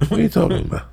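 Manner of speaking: high, baffled protest; incredulous. Acted. Newly booked actor challenges the union caller, incredulous.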